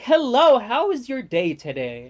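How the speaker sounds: high, loud chirpy service voice; mock the drive-thru worker's sunny script; customer service voice. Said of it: "it's like".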